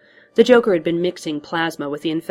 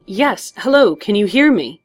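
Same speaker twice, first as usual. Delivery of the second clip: high, loud checking of the line; calling out; 4 semitones higher, 7 dB louder than usual. A narrator's reading. Man answers butler over bad line, calling out. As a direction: make yourself heard through static.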